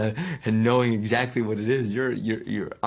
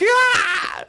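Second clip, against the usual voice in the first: high startled shriek; a sudden frightened cry out; startled yell; 8 dB louder than usual. Retold or imitated.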